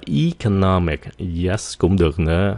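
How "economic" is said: economic starting with long ee; alternative first vowel. Shown as a demonstration. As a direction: say "economic" as ee-co-NOM-ic. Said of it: "nhiều người phát âm là".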